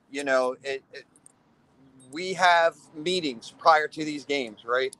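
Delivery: ordinary delivery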